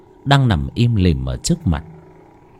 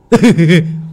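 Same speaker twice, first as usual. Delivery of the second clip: gleeful laugh; 7 semitones higher, 6 dB louder than usual. Acted. Servant gloats over master's death.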